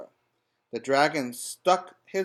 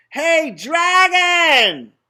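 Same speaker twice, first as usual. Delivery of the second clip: much higher and louder, drawn-out shout; shouting as loud as possible; 12 dB louder, much slower than usual. A narrator's reading. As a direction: yell it right into his ear. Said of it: "She shouted as loud as she could".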